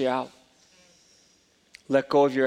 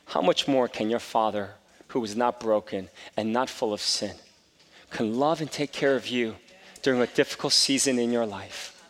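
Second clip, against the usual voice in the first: ordinary talk, nothing marked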